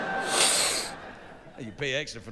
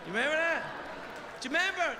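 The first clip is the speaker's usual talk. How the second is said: rising, pressing the crowd higher each time; mock insistence, much higher; insistent building; 12 semitones higher, faster than usual. Real, not acted. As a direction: push them to remember, climbing. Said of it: "everybody in the world was mad at".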